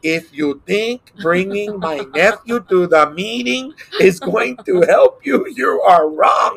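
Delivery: put-on slow, emphatic Mexican-accented English; heavy Mexican accent. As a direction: drag the words out in a thick Mexican accent, rising on the threat. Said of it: "in his very heavy Mexican accent".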